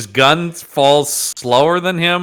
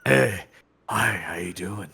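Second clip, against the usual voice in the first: smooth flirting; 6 semitones lower, 9 dB quieter than usual. Pretend. Mock sweet talk by Boba Fett.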